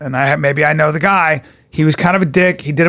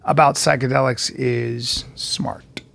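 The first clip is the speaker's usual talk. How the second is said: whisper; 6 semitones lower, 7 dB quieter, slower than usual. Real, not acted. Sudden whispered aside.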